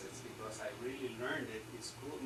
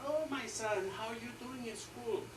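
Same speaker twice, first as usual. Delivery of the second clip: kindly priest; 10 semitones higher, 3 dB louder than usual. Retold or imitated. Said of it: "then the father says".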